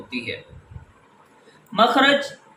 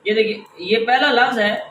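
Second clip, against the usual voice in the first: usual speaking voice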